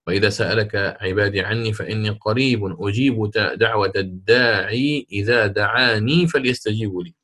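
usual speaking voice

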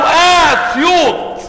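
high, drawn-out mock call; slow chant-like call in imitation; imitated soldiers' call. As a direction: stretch out the first vowel like troops calling. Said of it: "فقالوا".

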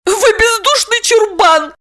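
very high, squeaky offended woman; cutting retort. A narrator's reading. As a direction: snap back in a squeal. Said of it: "отрезала Липочка".

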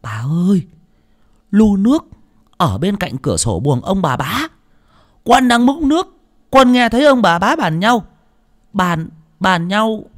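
put-on high, loud, frightened child voice; boy confiding urgently. A narrator's reading. Servant boy confiding an overheard plot to the old lady, urgently. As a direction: stammer out the secret in alarm. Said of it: "nó mới ghé tai bà già mà thủ thỉ".

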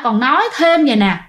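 ordinary delivery

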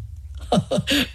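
relieved chuckle. Acted. Curate relieved at villain's death.

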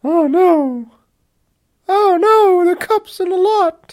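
high mocking oh no, the cups in the lot; sarcastic falsetto panic over trivia; high mock-horror sarcasm; mock alarm. Mocking the bosses' worry.

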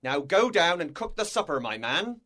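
loud, brusque command; commanding captain. A narrator's reading. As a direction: order a subordinate below. Captain orders the cook.

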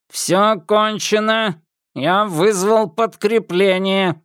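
high, slow, metallic enforcer voice; eerie metallic voice. A narrator's reading. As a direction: drag it out, cold and mechanical. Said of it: "предупредил он жутким металлическим голосом".

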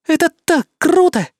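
high, loud thrilled catgirl; loud and excited. Acted. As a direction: squeal it with delight. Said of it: "Мэй сказала это достаточно громко". (громко и восторженно)

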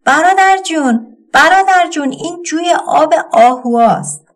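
a sister's warning cry, high and loud; calling out. A narrator's reading. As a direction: call urgently after a running brother. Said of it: "داد زد".

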